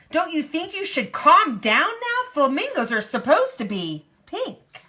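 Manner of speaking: high, loud storybook reproach; exasperated scolding. A narrator's reading. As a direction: tell her off with a high-pitched lilt. Flock scolds the angry flamingo.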